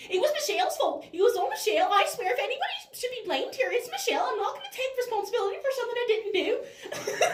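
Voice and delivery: put-on whiny, high-pitched Northern Irish girl; panicky, protesting Northern Irish whine, very high; Claire from Derry Girls. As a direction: wail the blame in a Northern Irish accent. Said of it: "doing an impression of her character".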